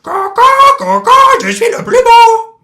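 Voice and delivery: very high, loud squawking parrot; rattling parrot voice. Acted. Parrot showing off to the cat in a shrill squawk. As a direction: screech it out like a vain parrot. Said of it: "de sa voix de crécelle".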